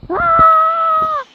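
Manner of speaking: high, loud mock scream. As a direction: let out a play-terror shriek. Playing at disaster, screaming.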